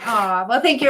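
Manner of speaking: usual speaking voice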